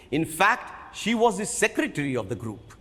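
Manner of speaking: ordinary delivery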